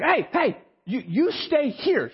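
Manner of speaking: slow down firmly on the order, mock panic; urgent order. Orders wife to stay behind.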